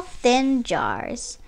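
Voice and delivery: usual speaking voice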